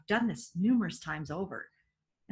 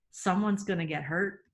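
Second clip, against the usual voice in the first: ordinary delivery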